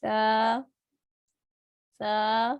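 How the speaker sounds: تا said gently with no hiss; a soft, unhissed taa. Shown as a demonstration. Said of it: "Repeat again". (تا نرمی والی آواز سے)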